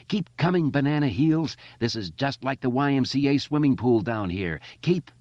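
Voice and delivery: high, loud jeering yell; mock the bullies at full voice; taunting shout. A narrator's reading. Richie taunting bullies down pipe.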